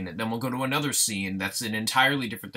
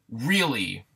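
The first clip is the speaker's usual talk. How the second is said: ordinary talk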